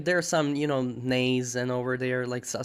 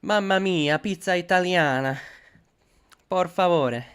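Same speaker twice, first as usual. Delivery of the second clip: hammed-up Italian, higher and louder; jolly italian pizza chef; 5 semitones higher, 5 dB louder than usual. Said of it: "Speak Italian".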